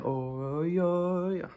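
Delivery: a high, mocking imitation of the song's "Oh, yo, yo"; mimicking the song. Reviewer mocking the singer's repetitive hook, high and teasing. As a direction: lightly parody the singer's hook.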